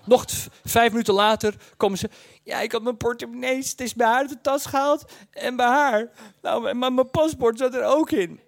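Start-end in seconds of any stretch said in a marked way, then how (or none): 2.4-8.4 put-on higher, sheepish teenage girl; young girl voice. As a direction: raise it like an anxious teenager. Girl admitting lost wallet.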